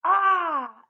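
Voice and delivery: eager burst of delight; excited exclamation. A narrator's reading. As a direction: gasp it out with sudden excitement. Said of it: "exclaimed the sister in excitement".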